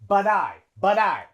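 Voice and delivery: "But I" linked like "bud-eye"; flapped T. Shown as a demonstration. Teacher demonstrates the D sound, linked. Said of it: "T in but is like a D sound".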